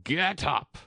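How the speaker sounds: shrill queen's command; high, piercing royal order; shrill, loud. A narrator's reading. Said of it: "in a shrill, loud voice".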